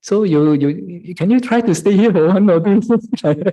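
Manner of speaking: high, coaxing plea to the monk; hammed-up pleading devotee; sheepish devotee. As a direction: sweet-talk the monk into staying. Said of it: "then they tell you".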